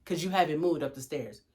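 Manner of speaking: low, quiet restraint; hushed, controlled mom voice; patient repeat. Acted. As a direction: keep it soft and low. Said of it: "Then I say again".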